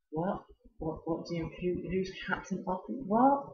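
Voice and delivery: put-on high, puzzled girl's voice, rising on the last what; mock-dim, high confused questioning; imitating a confused girl. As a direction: play a baffled pupil who missed the joke. Confused student quoted.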